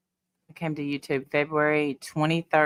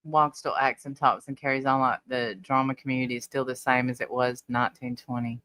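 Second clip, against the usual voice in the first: normal talking voice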